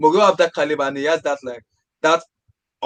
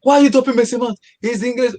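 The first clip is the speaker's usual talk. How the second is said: indignant fans; 9 semitones higher than usual. Retold or imitated. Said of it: "people like".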